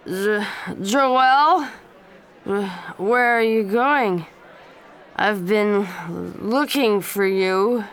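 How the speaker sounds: put-on slow, loud slurred drunk voice; drunk slurring. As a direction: slur the words like a drunk young man. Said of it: "His voice was not clear. It was slurred".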